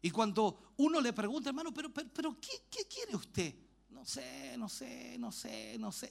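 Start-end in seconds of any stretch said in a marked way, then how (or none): 1.5-3.5 pleading question. Retold or imitated. Pastor asks believer pleadingly. 3.9-6.1 soft, helpless repeated mumble. Retold or imitated. Believer answers the pastor, quietly unsure.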